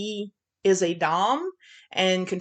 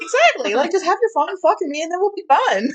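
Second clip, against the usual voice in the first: high mocking voice; 10 semitones higher, 6 dB louder than usual. Mocking the character's reasoning.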